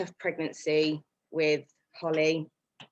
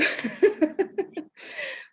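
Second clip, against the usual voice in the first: a sudden high laugh. Real, not acted. Admitting to the audience she could not work, laughing. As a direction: laugh at the obvious answer.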